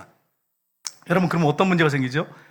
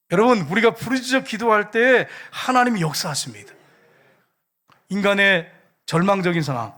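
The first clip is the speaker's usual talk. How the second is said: raised, emphatic sermon delivery; rousing preacher's blessing; forceful preaching; 3 semitones higher, 4 dB louder than usual. Real, not acted. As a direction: drive the exhortation home to the congregation.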